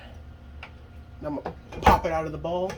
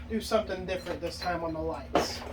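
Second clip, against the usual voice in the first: normal talking voice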